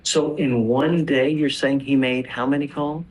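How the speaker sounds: normal talking voice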